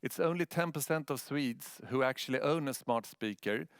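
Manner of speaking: normal talking voice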